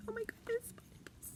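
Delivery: breathless thrilled whisper; excited whisper. Real, not acted. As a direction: whisper it like a secret treasure. Thrilled over pineapple erasers.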